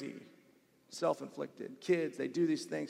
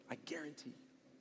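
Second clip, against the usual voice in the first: whisper; 17 dB quieter than usual. Real, not acted. Emphatic repeat, dropped to whisper.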